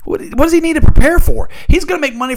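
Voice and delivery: heated shout. Real, not acted. Host shouts at pundits over the NIL money, heated yelling. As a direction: yell it, fired up and exasperated.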